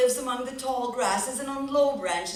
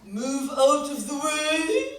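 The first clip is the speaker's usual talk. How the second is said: a haughty, slow, louder shove; Peacock bossing Robin; 4 semitones higher, 7 dB louder, slower than usual. Acted. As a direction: strut and order him aside. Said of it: "he'd say".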